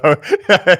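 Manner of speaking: bright burst of high laughter; real laugh. Real, not acted. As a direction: laugh out loud at him. Laughing at embarrassed producer.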